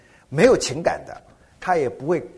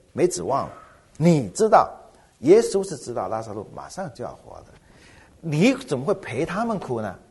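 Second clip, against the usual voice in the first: ordinary talk, nothing marked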